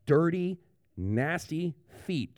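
slow, disgusted drawl on nasty feet. Retold or imitated. Pastor mimicking Jesus's disgust at his own dirty feet. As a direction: let the disgust drip from it. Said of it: "Jesus would say that about his own feet".

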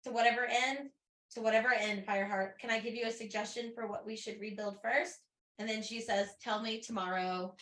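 soft, quiet reading of the last lines; hushed audiobook-style read; story narration. A narrator's reading. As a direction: read the ending tenderly. Reading the final passage aloud.

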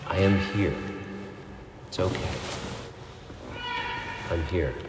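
put-on soft, hushed comfort for a crying baby; consoling a baby. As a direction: soothe it like a parent at the crib. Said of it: "Like a good mother or father consoling a baby".

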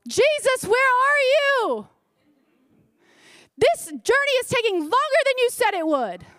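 high, pleading complaint to Jesus; whiny complaining believer. Retold or imitated. Preacher acts a believer complaining to Jesus, high and whiny. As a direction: whine at Jesus like a let-down child. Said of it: "we can be a lot like this".

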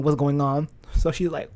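ordinary delivery, nothing marked

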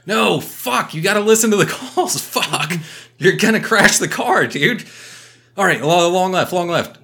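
high, loud panicked yelling with a laugh breaking in; a retold co-driver losing it, high and loud; mock co-driver yelling. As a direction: shout the calls like a frantic navigator.

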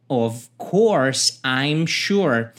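very slow "Of course I'm sure"; slow teaching demo; slow model line. Shown as a demonstration. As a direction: drag each word for learners. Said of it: "É claro".

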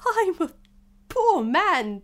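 high, loud, wailing plea. Acted. Hatter pleads with King.

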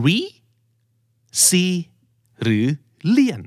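"re", "ซี", "เลียน" as separate beats with pauses; quiz-style syllables, long gaps between each. Shown as a demonstration. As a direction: break the word into three choices. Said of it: "คำนี้เน้นเสียงตรงไหนครับ".

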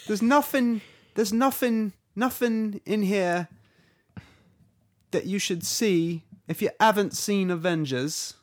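high, loud fake Cockney; Tom Holland. British actor warning of trailer spoilers, bad Cockney. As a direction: stumble through a hammed-up British warning. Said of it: "Tom Holland comes on".

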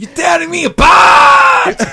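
huge, high, drawn-out anguished scream; Tommy Wiseau. Acted. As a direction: bellow it slowly, completely overacted. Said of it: "Say it like Wiseau".

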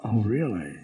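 lightly voiced emperor sneering at a complainer; mocking emperor. Roman emperor scoffing at a citizen, low and mocking. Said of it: "That emperor would look at you and say".

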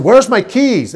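loud, high-pitched demand; mock demanding call. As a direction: holler it to wake her. His routine for rousing wife.

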